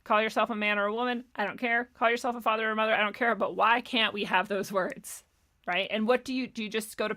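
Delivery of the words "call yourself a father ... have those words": ordinary talk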